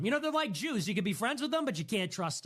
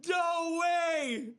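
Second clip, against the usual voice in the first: shocked shout; 8 semitones higher, much slower than usual. Retold or imitated. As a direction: shout in disbelief. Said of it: "You're like".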